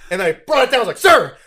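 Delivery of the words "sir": sudden loud high yelp of Sir; startled yell. Retold or imitated. Recruit yelping at his drill sergeant in panic. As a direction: blurt it out in a panic.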